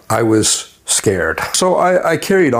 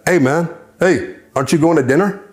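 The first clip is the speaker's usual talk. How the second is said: higher-pitched retold call for attention; calling cellmate; 6 semitones higher than usual. Cellmate calling the absorbed reader to dinner, high-pitched nudge. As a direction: call over to him, pitched up.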